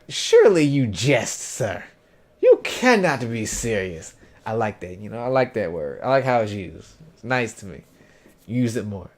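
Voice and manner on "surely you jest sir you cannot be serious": slow, grandly theatrical incredulity; hammed-up formal disbelief. Shown as a demonstration. Showing how people should say it, hammed-up courtly disbelief. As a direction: savour each word like a mock gentleman. Shows off the archaic phrase he likes.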